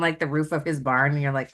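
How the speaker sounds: ordinary talk, nothing marked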